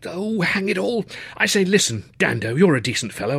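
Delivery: student pleading with the porter about the charge; wheedling young toff. Acted. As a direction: coax him like an old chum. Luscombe wheedling.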